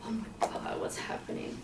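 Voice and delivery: breathy whisper of shock; a hushed retold gasp of dread; horrified whisper. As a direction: whisper it, frozen in horror. Hands covered in blood, alone.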